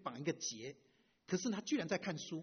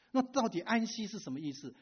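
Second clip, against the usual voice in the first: higher and louder, 那 punched with a falling tune; emphatic question; 5 semitones higher, 6 dB louder than usual. Real, not acted. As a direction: press the question home. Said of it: "那么到底安息是什么意思". (提高声音加重追问)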